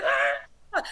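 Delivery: high, loud startled "Ah!" shriek; retold shriek. Man shrieking when the Lord touches him. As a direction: yelp as if burned. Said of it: "I shrieked back".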